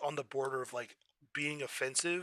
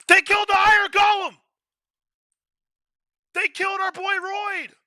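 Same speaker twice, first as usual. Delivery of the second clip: very high, loud shout of shock; outraged shout; 15 dB louder than usual. Real, not acted. Outraged that they killed our boy Royd, very high shout. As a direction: scream it out in disbelief.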